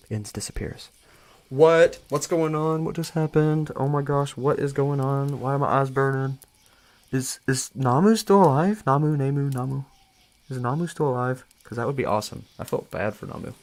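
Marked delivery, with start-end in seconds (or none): 1.5-11.4 higher and louder, disbelieving questions; real shock at a twist; shocked surprise. Real, not acted. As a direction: react like the rug was pulled out. Streamer reacts to the story ending.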